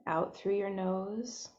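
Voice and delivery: soft, unhurried meditation voice with long pauses; hushed, spacious guidance into breath and body; guided meditation. Real, not acted. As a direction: gently guide listeners inward, leaving space between phrases.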